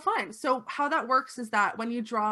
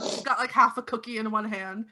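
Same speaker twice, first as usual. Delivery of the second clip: ordinary talk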